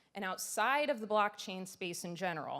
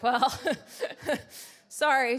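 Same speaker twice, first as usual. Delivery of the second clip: louder, higher, laughing out an apology; real laughter; 6 semitones higher, 10 dB louder, slower than usual. Real, not acted. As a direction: laugh helplessly through a sheepish sorry. Laughs retelling father's rebuke.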